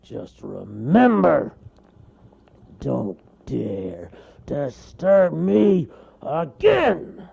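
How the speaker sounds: loud, slow bear roar; slow menacing cartoon bear roar; roaring warning. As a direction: drag out the warning. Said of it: "he roared".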